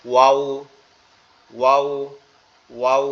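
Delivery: Arabic waw; rounded waw. Shown as a demonstration. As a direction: repeat ওয়াও for the class. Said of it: "দুই ঠোঁট এবং উপরের দাঁত থেকে".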